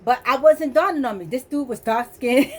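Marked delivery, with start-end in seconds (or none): 1.3-2.6 says it through a laugh, slowed; laughing at her own realization. Real, not acted. Woman laughing as she recalls noticing his dark skin.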